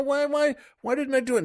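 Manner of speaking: high-pitched acted fretting; agonized self-reproach. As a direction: fret at yourself, pitched well up. Lawyer agonizing, cannot sleep.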